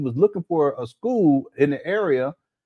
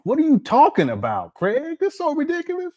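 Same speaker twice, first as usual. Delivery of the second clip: exasperated; 10 semitones higher than usual. Real, not acted. Mocking Craig's claim, exasperated.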